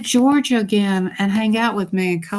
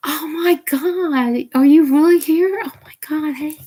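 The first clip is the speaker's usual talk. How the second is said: overjoyed surprise; 8 semitones higher, 3 dB louder than usual. Real, not acted. Greeting her cousin Amy on her live stream, overjoyed surprise. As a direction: gasp it out like she can't believe her cousin showed up.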